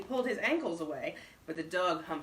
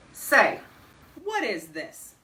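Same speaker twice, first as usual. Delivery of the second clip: suspiciously; 5 semitones higher, 12 dB louder, slower than usual. A narrator's reading. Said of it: "said Bullard suspiciously".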